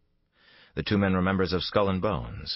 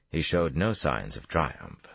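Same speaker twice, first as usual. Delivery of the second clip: ordinary delivery, nothing marked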